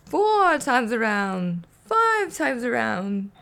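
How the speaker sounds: hammed-up vampire counting. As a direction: climb in glee with each number. Count counting faster spins.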